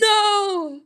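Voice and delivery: high emphatic refusal; playful no. Pretend. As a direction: shut the idea down with a squeal. Rejecting the idea loudly.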